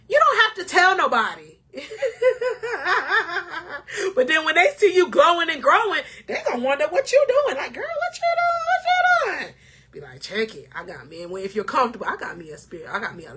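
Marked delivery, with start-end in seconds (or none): 1.8-4.1 a laugh that cracks into tears; real choked laugh; teary laugh. Real, not acted. Emotional laugh after tearing up. 7.7-9.5 put-on high, squealing nosy girlfriend; nosy friend. Nosy friend voiced in scene. 10.2-11.2 cool comeback. Retold or imitated. Her cool reply to friend.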